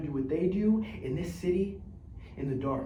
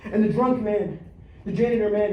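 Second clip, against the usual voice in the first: ordinary delivery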